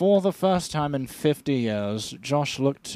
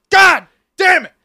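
loud, high, drawn-out yell; mock yell; 13 dB louder, slower than usual. Marco wakes up and yells in mock fury. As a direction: roar it slowly in rage. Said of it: "and he says".

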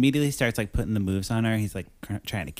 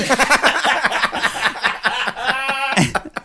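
high laugh; 4 dB louder than usual. Real, not acted. Laughing after the imitation.

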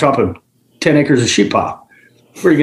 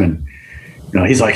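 normal talking voice

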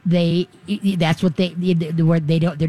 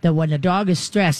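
ordinary talk

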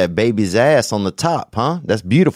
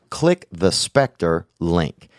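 slow emphatic pitch; 5 semitones lower, much slower than usual. Acted.

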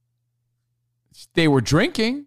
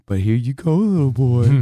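condescending. Retold or imitated. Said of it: "he does this condescending".

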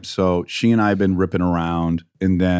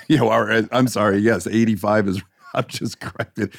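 ordinary delivery

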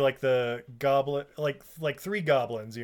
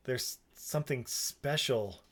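hushed mock invitation; suggestive acted Jack; 4 dB quieter than usual. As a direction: coax her along softly, with loaded pauses. Acting Jack luring Lily into woods.

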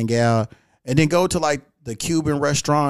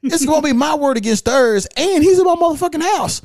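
higher-pitched, insistent self-defense; play-acted justification, climbing higher at the end; mock protesting excuse; 8 semitones higher than usual. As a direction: plead your case to imagined police, pitched up. Rehearses excuse he would give police.

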